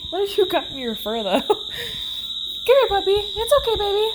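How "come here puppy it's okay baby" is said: soothing pet talk. Real, not acted. Owner calming her upset dog, high baby talk. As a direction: coo to calm a nervous puppy.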